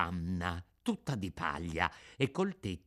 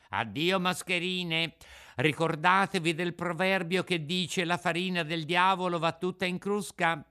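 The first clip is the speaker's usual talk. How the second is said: put-on raised, lively puppet-boy voice; scornful Pinocchio; 6 semitones higher, 3 dB louder than usual. Puppet refuses the begging swindlers, smug and scornful. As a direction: lecture them, then dismiss them.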